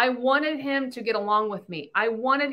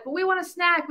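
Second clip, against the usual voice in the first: put-on kids whining; 7 semitones higher than usual. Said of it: "the kids are like".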